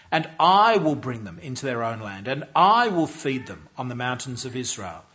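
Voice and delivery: preacher declaring God's promise from the pulpit; forceful scripture reading. Acted. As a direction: proclaim each "I will" like a herald. Reads God's promise aloud forcefully.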